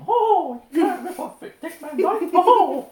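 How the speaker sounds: very high, loud, shrill mock outrage; outraged Disney lawyer. Imagining Disney complaining about the cockney impressions. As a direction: shriek it like a scandalised studio exec. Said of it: "we'll get Disney in here saying".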